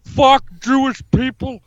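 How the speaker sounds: hammed-up tough-guy politician impression; comic strongman bluster; Jesse Ventura. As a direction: declare it like a blustering off-grid candidate. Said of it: "This is Jesse".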